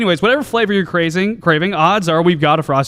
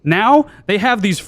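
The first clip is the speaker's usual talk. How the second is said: ordinary delivery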